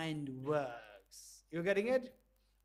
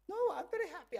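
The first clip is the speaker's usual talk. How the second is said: much higher, cheerful denial; high protest; 10 semitones higher, much faster than usual. Shown as a demonstration. Voice in the box denying to the teacher, much higher. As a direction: squeak it back much higher.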